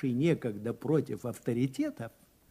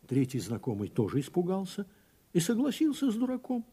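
usual speaking voice

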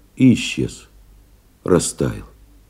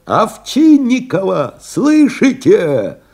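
high, slow, drawn-out call; настойчивый зов в трубку; 8 semitones higher, slower than usual. A narrator's reading. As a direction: call insistently through a bad line, stretching the words. Командир кричит в плохую связь. (протяжный высокий оклик командира)

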